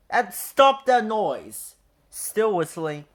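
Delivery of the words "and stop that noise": very loud, high furious bellow. A narrator's reading. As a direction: bellow it over the whistle noise. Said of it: "he bellowed".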